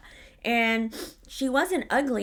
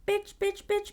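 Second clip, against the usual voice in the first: high monotone siren repeat; imitated warning alarm; alarm going off; 9 semitones higher, 3 dB quieter than usual. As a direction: chant it like a beeping alarm, all on one high note. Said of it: "Alarms were just going off, like".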